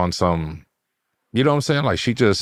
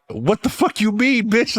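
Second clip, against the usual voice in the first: very high, drawn-out mock indignant squeal; mock outrage. Guessing at the caught girlfriend's comeback, in high mock outrage. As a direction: imitate an indignant girlfriend, pitched way up and slow.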